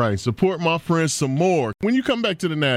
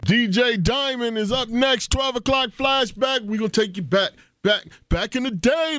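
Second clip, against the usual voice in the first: slow, higher announcer voice; radio announcer; 7 semitones higher than usual. Acted. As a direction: hype the next show slowly. Radio host announces next segment.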